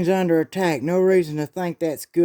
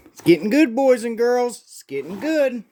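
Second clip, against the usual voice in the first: gleeful gloating; 6 semitones higher, 3 dB louder than usual. Acted.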